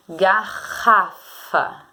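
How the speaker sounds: "Garrafa" drawn out very slowly, syllable by syllable; slow sounding out. Shown as a demonstration. Teacher sounding out "Garrafa" for pupils, very slow. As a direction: stretch every syllable of "Garrafa". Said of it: "falar com calma pra você descobrir".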